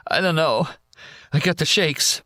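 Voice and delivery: barely holding back tears; tearful. Acted. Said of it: "a man sitting against that big tree crying".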